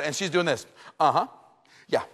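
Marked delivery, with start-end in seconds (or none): none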